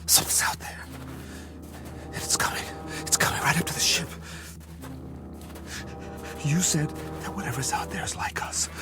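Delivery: hushed, breath-only whispering; frightened whisper. Acted. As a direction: whisper as something creeps closer. Alone, hearing something approach outside.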